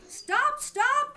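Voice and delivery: high loud call of stop. A narrator's reading. Rabbit in the garden calling out for him to stop. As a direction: shout across the garden. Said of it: "called the rabbit".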